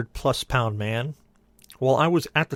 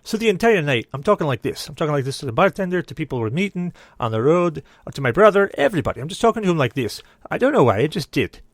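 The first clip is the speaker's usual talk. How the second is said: lively, raised Scottish accent; 3 semitones higher, 4 dB louder than usual. Shown as a demonstration. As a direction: put on a Scottish accent throughout. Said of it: "going to talk in a Scottish accent".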